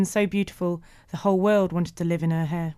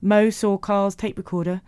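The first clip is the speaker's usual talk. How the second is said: usual speaking voice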